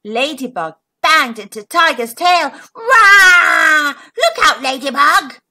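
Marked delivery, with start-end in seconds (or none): 2.7-5.4 loud roar then warning; hammed-up tiger roar. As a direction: roar like a big cat. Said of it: "he roared".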